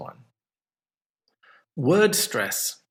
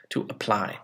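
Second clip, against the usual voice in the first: to a-PLY, softly, weight at the end; stress on second syllable; 4 semitones lower, 5 dB quieter than usual. Shown as a demonstration. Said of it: "It was on the second syllable".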